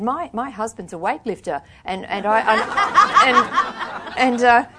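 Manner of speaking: real laughter breaks up the words; laughing. Real, not acted. Author laughing while retelling the editor's story, really laughing. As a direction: laugh your way through it. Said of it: "laughs while retelling the editor's story".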